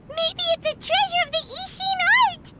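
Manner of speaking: high, eager and thrilled; excited guess. Acted. Paimon excitedly guessing to the group that the blade is treasure. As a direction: blurt it out like you've found treasure.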